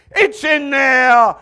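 slow, stretched high bellow; bellowed answer. Acted. God answering needy Christians, slow hammed-up bellow. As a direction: roar it, drawing out every word.

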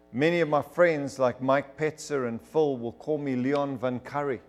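ordinary talk

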